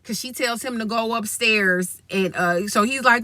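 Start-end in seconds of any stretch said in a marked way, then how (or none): none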